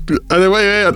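ordinary delivery

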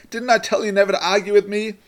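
high, loud triumphant gloat. A narrator's reading. Husband who thinks he has won the argument, gloating. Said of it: "Herschel gloated to Rivka".

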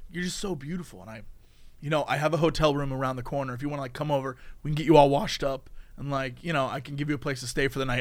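low, soft, smarmy come-on; creepy guy. Retold or imitated. Man hitting on a girl, low and smarmy. Creepy guy's pitch voiced.

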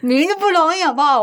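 high, loud objection; indignant protest. Real, not acted. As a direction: come on, it's hard. Protesting friend's claim.

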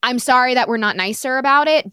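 normal talking voice

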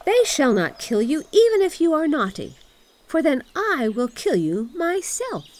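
put-on high, loud child voice; bossy little robber girl. A narrator's reading. Robber girl both protects and threatens Gerda, bossy. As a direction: declare it high and forceful.

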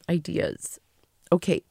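ordinary delivery

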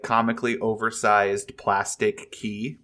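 usual speaking voice

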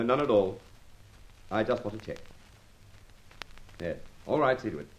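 quiet phone orders. Acted. Boss gives phone orders quietly.

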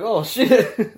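mock startled reaction on hearing the shout. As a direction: jump at a sudden yell. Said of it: "I was like".

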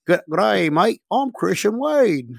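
put-on Australian accent, drawn out on the name. Player introducing himself at the coach's door, mock Australian accent. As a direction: do a cheery-greeting mock Australian. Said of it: "not Australian".

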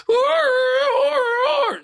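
very high, drawn-out animal wail; imitated hurt-dog howl. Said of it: "you might be a hurt dog".

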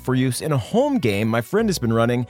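upbeat sponsor read. Acted. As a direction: sell it like a podcast ad.

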